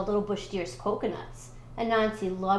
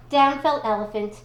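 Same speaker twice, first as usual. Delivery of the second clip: ordinary delivery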